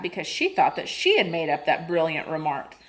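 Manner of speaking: usual speaking voice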